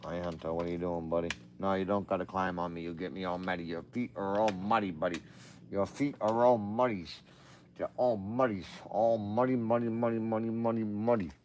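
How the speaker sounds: soft, sing-song pet talk; soft dog talk. Real, not acted. Talking softly to his muddy dog, cooing.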